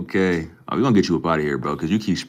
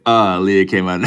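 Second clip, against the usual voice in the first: loud, higher, breaking into laughter; laughing exclamation; 4 semitones higher, 11 dB louder than usual. Real, not acted. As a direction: shout it as the laugh takes over. Startled, cracking up at who appeared.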